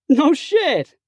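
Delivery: high, loud surprised outburst; sudden loud disbelief; bursting out. Acted. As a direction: blurt it out in delight. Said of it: "tattooed god guy bursts".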